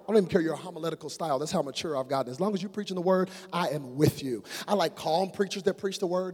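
ordinary talk

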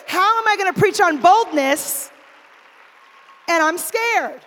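preaching on boldness; rising preacher's challenge. Real, not acted. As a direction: build it like a sermon punch. Rhetorical preaching climax.

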